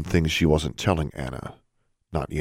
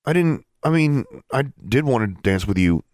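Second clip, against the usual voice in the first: flustered, higher-pitched stammer at the start; 7 semitones higher than usual. A narrator's reading. David assures her he wanted to dance, stammering. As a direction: stumble over the words, then insist. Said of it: "David was stammering now".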